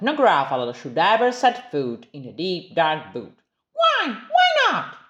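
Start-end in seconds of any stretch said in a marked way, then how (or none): 3.7-5.0 put-on squeaky little Gruffalo's child; high, eager child's question; bright little-monster voice, much higher. Child voice asking dad.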